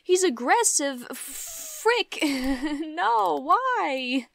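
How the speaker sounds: high, loud disbelieving cry; can't believe the new trait; shocked outrage. Real, not acted. Gamer reacting to her dog's new trait, outraged.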